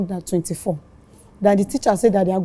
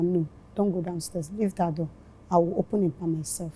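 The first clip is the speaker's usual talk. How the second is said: hushed warning; 2 semitones lower, 9 dB quieter than usual. Retold or imitated. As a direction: keep it down, voice dropped far softer. Telling daughter to stay inside.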